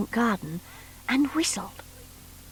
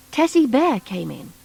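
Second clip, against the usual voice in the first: ordinary delivery, nothing marked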